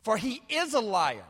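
high, forceful declamation; impassioned pulpit reading; preaching scripture as Jesus's rebuke. Acted. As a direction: drive the accusation home phrase by phrase.

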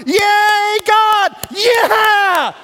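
high, full-voiced celebratory shout; wildly cheering trees. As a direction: whoop it up like a rejoicing crowd. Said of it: "the trees were like".

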